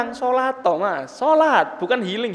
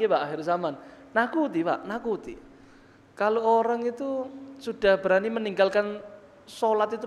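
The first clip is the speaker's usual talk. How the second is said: ordinary delivery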